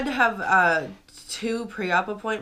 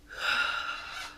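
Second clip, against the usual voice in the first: long breathy sigh of frustration; upset sigh; 6 dB quieter than usual. Real, not acted. Talking about the cancelled surgery, a heavy sigh. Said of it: "sorry, I'm very upset about this".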